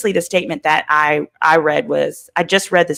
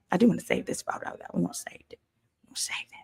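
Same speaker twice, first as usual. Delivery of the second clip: whispered aside; breathy, secretive whisper to herself; 13 dB quieter, much faster than usual. Real, not acted. Whispers aside about photo.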